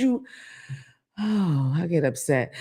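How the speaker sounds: usual speaking voice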